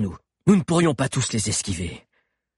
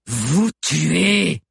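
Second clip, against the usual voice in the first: higher, grating savage chief; menacing broken-speech verdict, pitched up; 5 semitones higher, 4 dB louder than usual. A narrator's reading. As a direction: grate it out with raised pitch. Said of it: "d'une voix grinçante".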